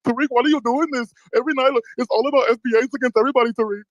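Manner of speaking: high, put-on whiny complaint; mock whining. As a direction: imitate a fed-up complainer in a high voice. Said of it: "Man, stop whining".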